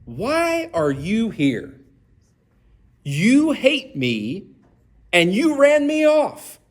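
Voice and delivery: slow, weary questioning of the king; frustrated. Retold or imitated. Preacher voicing Isaac to the Philistine king, slow and fed up. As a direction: drag each word out, fed up. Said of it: "He says / He seems very frustrated".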